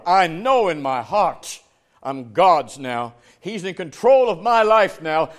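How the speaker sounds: loud, drawn-out, weighty declarations; full-force preaching. Real, not acted. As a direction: preach the certainty with all your force.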